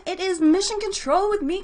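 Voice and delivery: usual speaking voice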